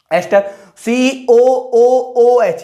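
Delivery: each letter of सीओओओएच stretched very slowly; drawn-out dictation. Shown as a demonstration. Teacher dictating the formula to students, very slowly drawn out. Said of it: "teacher drawing out formula letters".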